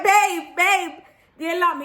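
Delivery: high, loud calling for babe; retold panicked call. Retelling calling her man about the alarm, mock panic.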